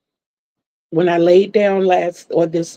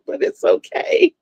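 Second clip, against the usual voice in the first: laughing through the words, higher; 14 semitones higher than usual. Real, not acted. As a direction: giggle it out. Laughing about missing the count.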